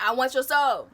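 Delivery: high, loud mocking voice; mock greedy voice. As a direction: ham up a soul-hungry demand. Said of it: "They be like".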